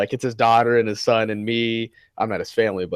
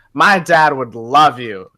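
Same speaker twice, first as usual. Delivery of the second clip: mock drunk lady; 4 dB louder, much slower than usual. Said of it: "And she goes".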